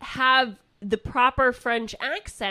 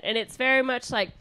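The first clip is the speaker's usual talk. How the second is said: ordinary talk, nothing marked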